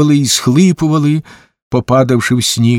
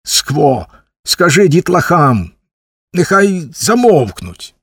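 higher, slow, insistent command; irritated, raised-pitch demand; angry order; 5 semitones higher, slower than usual. A narrator's reading. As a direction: order it crossly, drawing out each phrase. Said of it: "сердито кинув йому Куций".

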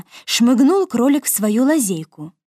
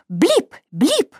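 blip sound effect; 7 semitones higher than usual. A narrator's reading. Sound of stick blows.

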